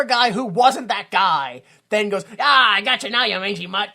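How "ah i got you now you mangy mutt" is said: lightly acted villain's taunt, loud at the start; imitated triumphant jeer; mock gloating. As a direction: crow at a dog you've caught, half-hearted villain. Voices man gloating at dog.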